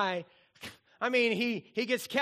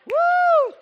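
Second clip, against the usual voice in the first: loud high whoop; 15 dB louder than usual. Real, not acted. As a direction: let out a big excited whoop. Crowd reacts to song.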